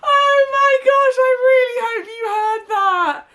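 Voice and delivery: high squealing laughter; laughing. Real, not acted. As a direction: squeal through the giggles. Laughing at the puppy's fart.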